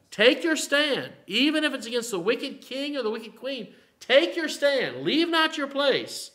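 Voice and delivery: rallying the flock to stand their ground, in a booming preaching voice; full-force preaching. Acted. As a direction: thunder it out like a pulpit exhortation.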